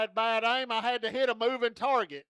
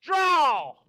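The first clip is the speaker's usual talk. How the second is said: showdown shout; 4 semitones higher, 5 dB louder than usual. Acted. Starting the mock gunfight.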